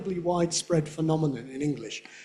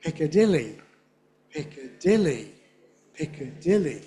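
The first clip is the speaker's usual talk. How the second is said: "Piccadilly" stressed on DIL, falling; dictionary stress. Shown as a demonstration. Teacher giving the class the word alone, dictionary stress. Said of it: "The stress is what you see in a dictionary".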